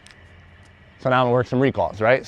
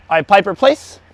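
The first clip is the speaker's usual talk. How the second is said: raised call of the dog's name; firm recall; 6 semitones higher, 5 dB louder than usual. Real, not acted. Trainer calling dog to come, raised. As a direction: call her in with energy.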